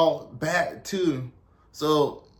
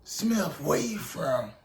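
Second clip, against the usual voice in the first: softer put-on sergeant interrogation; Drill Sergeant Kickass; 5 dB quieter, slower than usual. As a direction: question a recruit as a mock drill sergeant. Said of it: "he was like".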